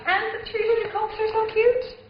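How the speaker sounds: cooing. Real, not acted. Olive coos to the chef over the cute little cups. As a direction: gush like they're adorable.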